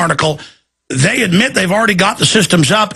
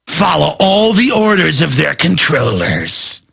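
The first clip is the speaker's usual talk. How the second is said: ordinary talk, nothing marked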